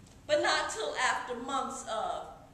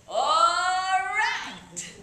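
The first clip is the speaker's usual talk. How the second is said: high, loud, drawn-out cheer; eager agreement; 6 semitones higher, 9 dB louder than usual. Acted. Child agreeing to run off to Haiti, eager. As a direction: shout a gleeful, stretched yes. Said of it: "And I said".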